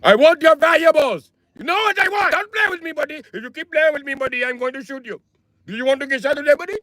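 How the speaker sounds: put-on very high, squealing Indian accent. Indian robber threatening his victim, high mock accent. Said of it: "Can you imagine a robber being like".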